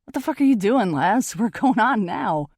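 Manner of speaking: loud, high, urgent shout; calling out. A narrator's reading. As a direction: holler to a bandmate to hurry up. Bandmate calls out from door.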